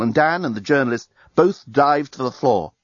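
ordinary delivery, nothing marked

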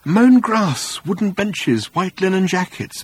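high, dreamy listing; rapturous pleasure. A narrator's reading. Arthur taking in English sights, with pleasure. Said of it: "he added with pleasure".

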